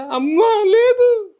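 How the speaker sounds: high, slow, tearful wail; a small boy sobbing that his mother is gone; crying like a child. Retold or imitated. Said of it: "ఏడుతున్నాను మాట, చిన్నపిల్లాడిని కదా". (చిన్నపిల్లాడిలా ఏడుస్తూ)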